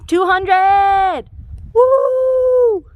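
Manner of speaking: loud high victory whoop; excited cheer. Real, not acted. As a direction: cheer it out at the milestone. Reaching two hundred mile mark.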